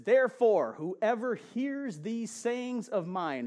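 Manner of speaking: slow, emphatic Bible reading; scripture reading. Acted. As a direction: read it out like holy writ, slowly.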